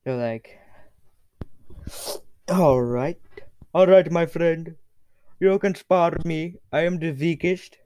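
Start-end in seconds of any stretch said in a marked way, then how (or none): 2.5-7.8 higher, louder king voice; cocky character voice. Acted. King agrees to spar with challenger, high and loud. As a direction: accept the challenge loudly in character.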